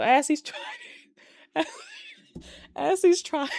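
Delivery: high, laughing too hard to finish; cracking up. Real, not acted. Recapper retelling the bed escape, really cracking up. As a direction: break into helpless giggles mid-sentence. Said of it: "It should not be funny".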